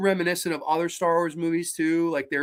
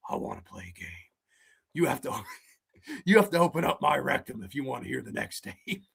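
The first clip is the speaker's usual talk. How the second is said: much lower, softer creepy voice breaking into laughs; mock Jigsaw; 7 semitones lower, 6 dB quieter, faster than usual. Mocking Jigsaw hiding a lightsaber, in a low creepy voice. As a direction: growl it like a horror villain. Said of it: "like Jigsaw hiding the tapes".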